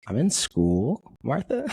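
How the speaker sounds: exasperated, voice leaping up on Martha; incredulous. Retold or imitated. Answering a friend's odd call, incredulous and high. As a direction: spell out the obvious to a friend. Stunned reply to odd question.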